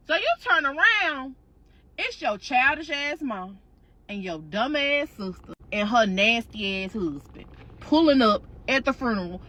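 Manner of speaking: normal talking voice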